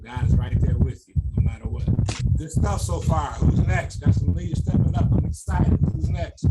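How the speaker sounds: loud and pumped; excited coach. Real, not acted. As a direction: hype up the next leader. Coach pumping up players.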